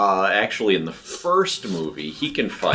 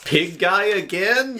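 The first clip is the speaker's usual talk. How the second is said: higher, slow, weary mock complaint; mock exasperated viewer; 12 semitones higher, slower than usual. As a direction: drag it out like a tired fan. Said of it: "for each are things like".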